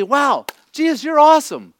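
high, loud, thrilled "Wow, Jesus"; amazed praise. Retold or imitated. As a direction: gush with wonder at a miracle. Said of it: "You'd be".

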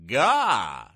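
loud cartoon "Gah!" with a swooping pitch; exclaimed "Gah!". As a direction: blurt out a comic-strip yelp, rising then falling. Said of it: "G A H exclamation point".

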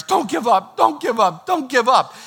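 high, insistent urging from the man being carried; urgent pleading. Acted. Man on the mat urging friends.

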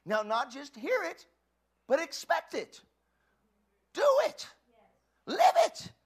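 building to a high shout; rising exhortation. Real, not acted. Preacher urging the congregation, building to a shout. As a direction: charge them to live it.

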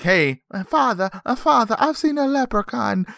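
high-pitched mock little boy voice; small boy. Boy tells his father of the leprechaun, high child voice. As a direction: squeak it like a kid calling his father. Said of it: "the small boy has actually seen him".

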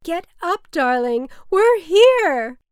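loud, high calling out; shouting up from the street to wake her; excited shout. Acted. Mother calling from around the corner.

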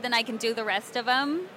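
ordinary talk, nothing marked

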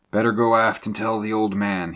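a touch of shaken nerves; faltering. A narrator's reading. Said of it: "he faltered".

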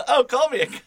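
high, laughing put-on voice; mock impression of friend. Cohost imitating absent friend welcoming insults, high mock voice. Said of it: "He's like this one".

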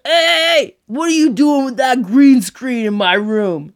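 loud hey hey, protesting. Retold or imitated. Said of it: "he's like".

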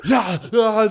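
high, loud, gasped agreement; mock choking on hot salsa, desperate for water; imitated gasping. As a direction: squeeze the words out between acted gasps. Imitates choking man wanting water.

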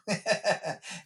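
short amused laugh; light laugh. Real, not acted. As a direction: chuckle at the joke. Laughs at own joke.

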